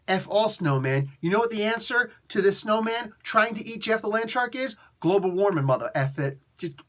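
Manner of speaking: ordinary delivery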